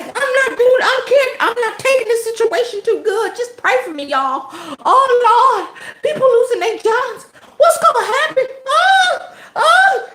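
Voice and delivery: high, loud whiny mock lament, cry-laughing; shrill mock wailing over lost jobs; mocking her wail. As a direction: ham up her panicked plea. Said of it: "she was like".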